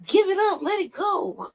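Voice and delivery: high exasperated give-up; high pleading exasperation; exasperated plea. Real, not acted. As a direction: beg him to quit, pitch way up. Pleading at the streamer.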